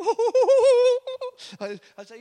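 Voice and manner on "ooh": high, loud, sustained "ooh"; imitated whoop. Preacher re-enacts the healed woman's reaction, high mock whoop. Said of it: "And you go".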